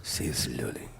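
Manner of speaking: whispered, breathless echo; hushed mocking repeat of the line. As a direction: whisper it back like a secret. Echoes the sultry line mockingly.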